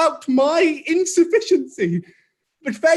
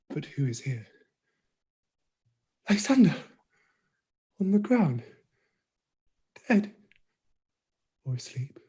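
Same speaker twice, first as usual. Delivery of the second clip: hushed; 7 semitones lower, 8 dB quieter, faster than usual. Acted. Helena finds body.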